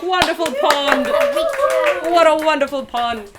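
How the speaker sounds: high, loud, slow gushing praise; lavish the guest with glee; delighted praise. Real, not acted. Host gushes over the guest's Pond voice. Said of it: "first person to do any voice".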